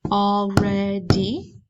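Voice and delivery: Already said slowly, AL-READ-Y; slow teacher count of Already; syllables tapped out. Shown as a demonstration. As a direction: give Already one beat per syllable. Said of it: "saying these and clapping on your table".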